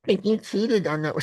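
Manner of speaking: high put-on heckler voice; mocking heckler. Heckler interrupting the streak brag, mocking high voice.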